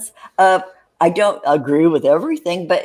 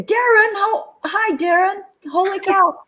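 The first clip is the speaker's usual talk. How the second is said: excited greeting; 13 semitones higher than usual. Real, not acted. Host surprised by unexpected caller.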